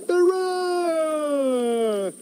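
high, long drawn-out hurrah; animals' cheer. A narrator's reading. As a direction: cheer it high and let it slide down. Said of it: "cried the animals".

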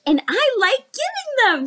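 high bright-voiced reply; cheerful young dolphin. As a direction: chirp it back happily. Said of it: "said Kai".

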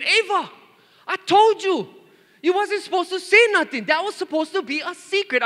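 very high, loud exasperated imitation; hammed-up mother telling a child off; mock scolding wife. Wife scolding daughter over spilled secret, mock exasperation.

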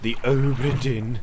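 slow ominous name. Acted. Game character speaks the name.

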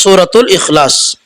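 normal talking voice

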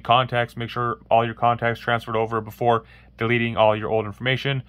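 ordinary talk

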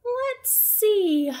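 a hint of a drowsy bear; slow, sleepy musing; lightly voiced sleepy bear. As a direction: Bear thinking it over half asleep.

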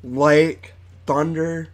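slightly lisping around false teeth; talking with fake teeth in. Real, not acted. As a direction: mouth stretched over dentures. Seen 1: Teeth inserted at -4, mouth open showing them while talking.

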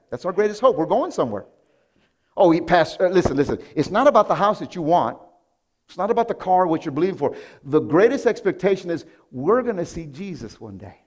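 loud, high preaching; preaching at full force. Acted. Pastor exhorting the church, loud preaching. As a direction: drive it home to the crowd.

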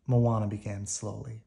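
ordinary delivery

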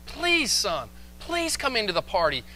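high, imploring father's plea; pleading. Acted. Father begs angry son to join the party, pleading. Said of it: "he has to plead with him".

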